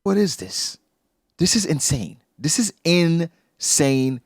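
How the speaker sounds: high, stretched cry of disbelief. Real, not acted. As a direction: drag out "This is insane" in shock. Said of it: "reacting in disbelief".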